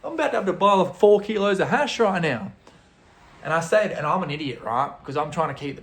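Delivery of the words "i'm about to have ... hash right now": high, loud comic dismay; retold alarmed reaction. Visitor realises he must buy four kilos, mock alarm. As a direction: act out a stunned realisation, voice jumping up. Startled thought after being yelled at.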